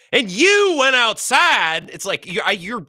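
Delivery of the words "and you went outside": much higher, louder and very slow, like a sudden shout; imitating Foxworthy's comic storytelling voice; Jeff Foxworthy inflection. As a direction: stretch every word like a comic's big southern punchline. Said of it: "it's the inflection".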